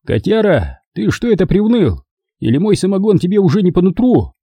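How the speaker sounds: grandiloquent booming; pompous. A narrator's reading. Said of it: "Напыщенно и велеречиво прогудел Мамай".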